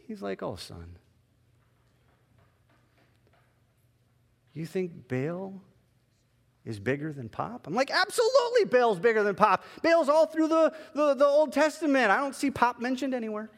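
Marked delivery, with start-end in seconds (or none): 0.4-7.7 gentle amusement. Retold or imitated. God gently teasing pastor. 7.9-13.5 higher and louder, eager to win the point; insistent protest. Retold or imitated. Pastor insisting to God that Baal outranks pop, higher and louder.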